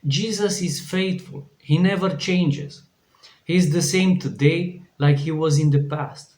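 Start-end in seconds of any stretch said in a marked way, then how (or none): none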